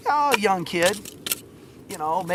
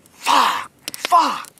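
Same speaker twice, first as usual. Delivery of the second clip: loud, high bursts of disbelief; shocked cursing; 8 semitones higher, 8 dB louder than usual. Retold or imitated. Stranger stunned by a correct guess, shocked outburst. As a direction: blurt each curse out in retold shock.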